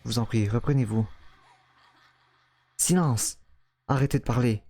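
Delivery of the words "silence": shouted. Acted. Man snaps at the woman.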